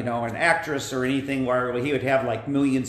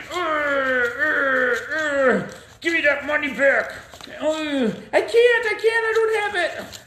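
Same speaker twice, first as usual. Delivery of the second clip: higher, strained choking grunts; choking struggle; 12 semitones higher, 3 dB louder, slower than usual. Acted. Man is choked by his creditor, strained high grunts. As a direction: grunt and gasp while being choked.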